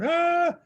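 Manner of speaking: sudden high yelp of pain; retold cry of pain. Friend stung by fire ants, high yelp. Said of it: "he starts crying".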